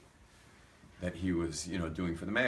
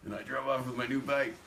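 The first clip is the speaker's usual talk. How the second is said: gleeful punchline; 7 semitones higher, 5 dB louder than usual. Real, not acted. Gleeful punchline straight after laughing.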